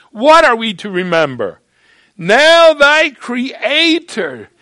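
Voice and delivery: loud, slow, pressing sermon voice; fervent preaching. Real, not acted. Preacher urging the young audience, slow and loud. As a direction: lean hard on every word.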